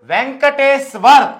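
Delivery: వెంకటేశ్వర్ slowly, syllable by syllable; pronunciation demo. Shown as a demonstration. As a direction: sound out VEN-KA-TEE-SWAR, landing on 'war'. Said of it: "వారంటే ఇది ఇలా రావటం".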